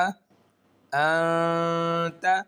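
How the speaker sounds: अ ता joined with a hidden nasal sound; ikhfa demo. Shown as a demonstration. Quran teacher demonstrating ikhfa. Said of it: "अब यहाँ पर इख्फा हो रहा है".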